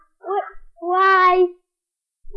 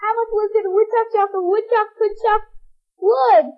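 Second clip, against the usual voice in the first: fast, higher woodchuck chant; rushed tongue twister; 3 semitones higher, faster than usual. Shown as a demonstration. Child racing through the woodchuck twister. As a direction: rattle it off at speed. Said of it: "You say that?".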